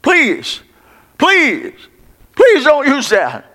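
high-pitched acted plea for mercy; begging for mercy. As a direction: plead high, drawing out each please. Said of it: "begin to cry for mercy".

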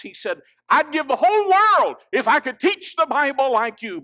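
high, loud pulpit delivery; ringing sermon shout; full-force preaching. Real, not acted.

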